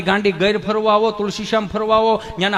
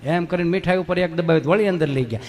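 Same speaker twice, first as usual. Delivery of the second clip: normal talking voice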